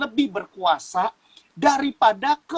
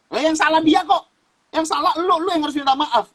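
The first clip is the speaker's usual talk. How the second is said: indignant ego; 7 semitones higher than usual. Retold or imitated. Voicing his own stubborn ego.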